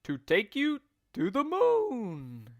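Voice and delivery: high, mock-surprised exclamation; hammed-up astonishment from the husband; exclaiming. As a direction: act shocked at the idea. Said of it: "exclaimed Mister Twit".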